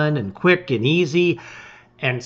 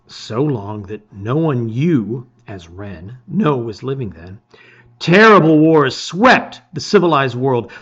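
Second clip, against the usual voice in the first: measured storybook narration voice; a reader opening a gamebook, narrating; story narration; 5 dB louder than usual. A narrator's reading. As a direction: read it like setting the scene of an adventure. Reads book intro as narrator.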